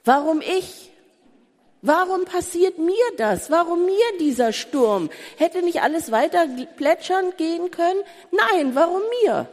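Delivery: high, pleading voice asking why Jesus sleeps; anguished complaint. Acted. Preacher voicing a desperate believer, pleading and high. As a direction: cry out the believer's despair at God's silence.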